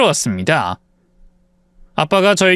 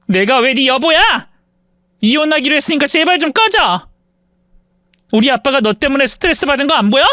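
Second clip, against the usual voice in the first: angry yelling; 8 semitones higher than usual. Acted. Wife yells at husband to leave, angry yelling. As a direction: drive him out with your voice. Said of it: "큰 소리를 치며".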